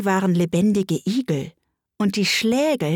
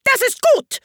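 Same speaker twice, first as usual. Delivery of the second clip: very high, loud approval; piercing royal yell; shrieking; 5 dB louder than usual. A narrator's reading. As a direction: shriek it like an imperious queen. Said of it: "schrie die Königin".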